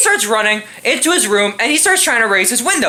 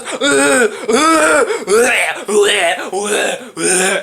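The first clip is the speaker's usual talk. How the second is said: imitated groaning; much slower than usual. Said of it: "And this dude goes".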